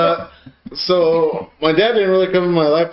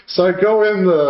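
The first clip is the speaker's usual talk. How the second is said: ordinary delivery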